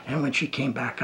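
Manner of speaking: ordinary talk, nothing marked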